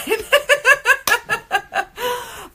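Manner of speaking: long high-pitched giggle; high cackling laugh, drawn out; real laughter. Real, not acted. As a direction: burst out laughing at your own morbid thought. Laughs at own morbid thought.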